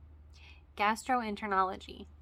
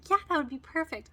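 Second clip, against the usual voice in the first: chirpy receptionist; 7 semitones higher, 7 dB louder than usual. Retold or imitated. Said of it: "And she's like".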